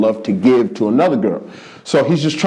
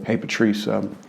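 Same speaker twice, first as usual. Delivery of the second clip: timid ask; 4 semitones lower, 6 dB quieter than usual. Retold or imitated. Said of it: "get up the gumption and just go".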